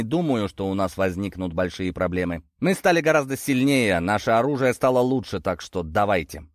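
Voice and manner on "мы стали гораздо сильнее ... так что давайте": plain reading voice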